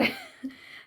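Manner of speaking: sudden high squealing laugh; embarrassed laugh. Real, not acted. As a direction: laugh at your own slip. Forgets director's name, laughs. (పేరు మర్చిపోయి సిగ్గుతో నవ్వు)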